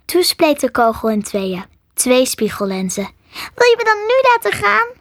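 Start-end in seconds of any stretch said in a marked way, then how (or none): 3.3-4.9 high, squeaky little request; tiny high-pitched creature voice; pleading squirrel. A narrator's reading. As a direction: ask hopefully as a small animal.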